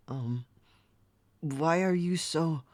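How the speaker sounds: normal talking voice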